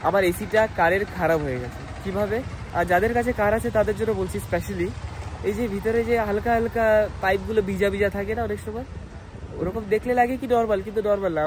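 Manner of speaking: ordinary delivery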